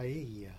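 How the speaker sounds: "paella" softly tried with a Y for the Ls; pa-EY-ya, "paella" with no L sound. Shown as a demonstration. Co-host trying it out, "paella" softly with a Y. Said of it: "The double L's a J or something".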